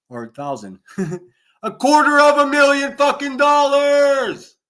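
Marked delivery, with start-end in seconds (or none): 1.6-4.6 high, loud, slow outraged yell; furious bellow of the dollar amount; full shout. Shown as a demonstration. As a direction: scream the number at the audience. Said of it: "you gotta say it like this".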